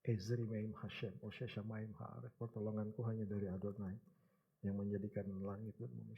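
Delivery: soft, low, fervent crying out to God; quiet, low prayer recalled from the hospital; hushed prayer. Retold or imitated. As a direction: pray it quietly as if in tears. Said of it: "Kita menangis, kita berseru".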